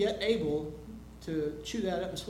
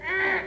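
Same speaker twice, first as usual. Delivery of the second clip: short, high defiant grunt; imitated toddler grunt; 11 semitones higher, 6 dB louder than usual. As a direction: mimic a toddler's stubborn little grunt. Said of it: "that little grunt thing".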